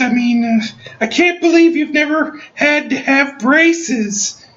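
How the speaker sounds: very high, gushing woman's voice; high falsetto mock dental hygienist. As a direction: gush like a fawning hygienist in falsetto.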